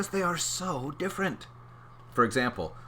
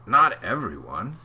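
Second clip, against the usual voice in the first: plain reading voice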